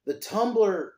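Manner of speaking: excited. Real, not acted. Excited to bring up Tumbler.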